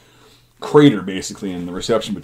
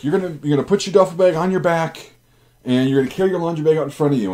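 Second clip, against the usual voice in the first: higher, brisk instructing sergeant; drill sergeant orders; 7 semitones higher, faster than usual. Retold or imitated. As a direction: rattle off the rules like a drill instructor. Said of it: "they're like".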